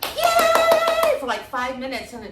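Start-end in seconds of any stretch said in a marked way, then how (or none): 0.0-1.2 a very high, loud whoop; over-the-top celebratory yay; wild cheer. Retold or imitated. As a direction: cheer like the crowd's going wild. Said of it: "And same enthusi".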